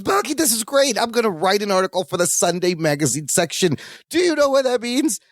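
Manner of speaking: high-pitched acted excitement; excited. Larry telling Balky about his magazine article, excited. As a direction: burst out with the news, voice jumping up. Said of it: "Larry runs over to Balky all excited".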